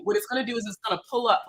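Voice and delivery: ordinary talk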